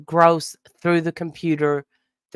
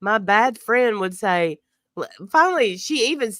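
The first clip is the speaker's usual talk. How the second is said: ordinary delivery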